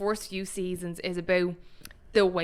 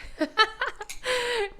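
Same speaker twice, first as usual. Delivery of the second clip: high laugh. Real, not acted. Laughing at Scott's Lord Disick shoes.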